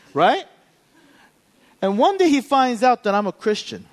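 ordinary talk, nothing marked

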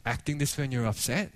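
softening, gently questioning; sit a child down and ask tenderly, voice sinking; retold gentle father. Father gently asking his upset daughter, softening voice. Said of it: "sat her down and was like".

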